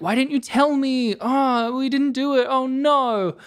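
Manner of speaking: high, lightly hammed-up distress; a light mock of hysterical grief; mock wailing. Imagined overwrought Denise, high and lightly wailing. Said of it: "not going like".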